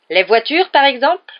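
loud, high, pointed question; accusing. A narrator's reading. Wife catching out her husband, accusing.

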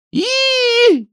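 very high, loud squeal; shrill squeal. A narrator's reading. Tayka squeals as she grabs Ninka's hair. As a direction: squeal and grab her hair. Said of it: "завизжала Тайка".

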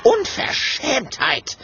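high, drawn-out outrage; affronted high-pitched protest; indignant exclamation. A narrator's reading. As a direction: cry it out, scandalized. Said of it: "ruft Clementia empört".

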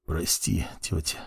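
low, soft grieving whisper. A narrator's reading. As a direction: whisper a quiet farewell. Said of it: "прошептал я".

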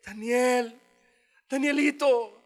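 grieving king calling into the lions' den, sad. Acted. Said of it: "con voz triste".

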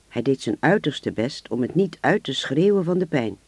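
ordinary delivery

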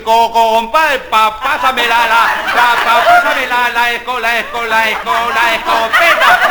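stammering hunter in a cow costume; halting, repeating each syllable; stuttering. Acted. Back half of the cow costume asking for the shotgun, stammering. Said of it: "el tartamudo de atrás le dice".

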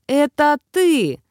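slow, high, theatrical cry; hammy stage actor. A narrator's reading. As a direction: ham it up like a stage diva. Grandmother theatrically greets Death.

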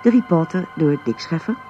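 ordinary delivery

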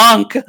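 a cracked goose-like honk; voice cracking. Pretend. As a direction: let the voice break into a honk. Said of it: "la voce che si rompe".

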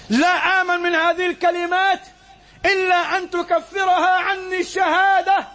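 high, slow, crying-out sermon voice; impassioned preaching. Acted. Preacher voicing Abu Hudhayfa's lifelong fear, high and slow. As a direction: plead it out high and drawn.

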